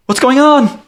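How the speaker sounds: high, loud call through the storm; Tandy calling. Acted. GM as Tandy calling through rain and wind, high and loud.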